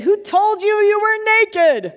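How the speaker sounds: God demanding an answer; God's sharp question. Acted. God confronts the man.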